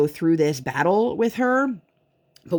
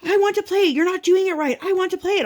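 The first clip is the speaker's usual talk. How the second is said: high-pitched mock tantrum; squealed kid protest, pitched way up; screaming child; 14 semitones higher than usual. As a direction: imitate a toddler wailing demands. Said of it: "Just screaming for an hour straight".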